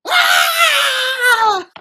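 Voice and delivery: loud high scream; long cry of being gulped down; swallowed scream. Acted. Hero swallowed whole by the cold, high scream.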